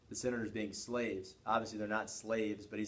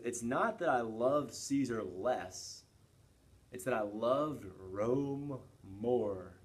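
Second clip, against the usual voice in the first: slow solemn quote; much slower than usual. Retold or imitated. Teacher quotes Brutus slowly, solemnly.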